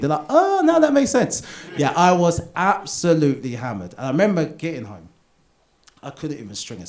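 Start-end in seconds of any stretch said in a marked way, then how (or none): 0.3-1.4 much higher, loud; mock knowing reaction. Friends in the room reacting, high mock knowing.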